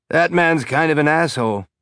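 put-on wry machine in a light Belter accent; tinny Belter accent. A narrator's reading. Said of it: "in a tinny belter accent".

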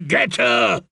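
urgent, rough-edged push; urging. A narrator's reading. Dornishman to the fool mid-fight, urging. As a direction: egg your partner on to attack. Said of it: "urged Timian".

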